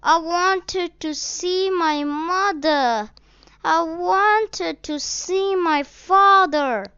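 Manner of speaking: put-on lost boy weeping to a stranger; weeping child. A narrator's reading. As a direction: voice of a child in tears. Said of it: "The child wept bitterly".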